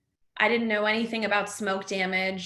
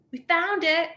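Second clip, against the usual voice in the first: retold scream; 8 semitones higher, 4 dB louder than usual. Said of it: "a firefighter screams out".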